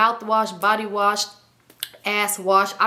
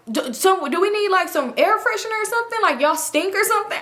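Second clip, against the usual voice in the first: teasing Clyde; 9 semitones higher than usual. Retold or imitated.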